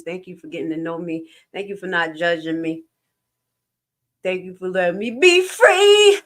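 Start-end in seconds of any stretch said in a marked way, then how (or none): 4.2-6.2 rising, louder heartfelt cry; drawn-out joyful declaration; emphatic gratitude. Real, not acted. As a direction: proclaim freedom slowly. Grateful declaration to viewers.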